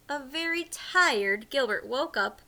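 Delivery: plain reading voice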